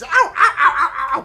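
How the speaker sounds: high, loud comic yelps of "ow"; cries of pain. Acted. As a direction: yelp in quick loud bursts, hammed-up. Acting a creature hit in game.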